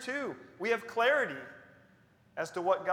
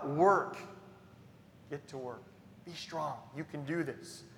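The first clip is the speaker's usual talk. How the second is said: much softer, reassuring; gentle encouragement; 7 dB quieter than usual. Acted. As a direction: coax them back to work. God's quiet encouragement quoted.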